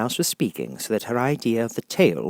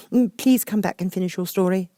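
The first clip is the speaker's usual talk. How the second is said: high, pleading call; Alice calling after the departing Mouse; 12 semitones higher, 5 dB louder than usual. Acted. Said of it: "Alice called after it".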